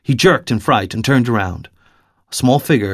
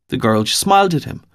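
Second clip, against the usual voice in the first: plain reading voice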